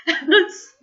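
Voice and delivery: high laughter spilling over the words; high giggling laugh. Real, not acted. Laughing at the memory.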